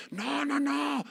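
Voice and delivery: high, mocking, slow; mock quote. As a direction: mimic the false preachers with a sneer. Mocking the ecumenical view. (burla aguda y lenta)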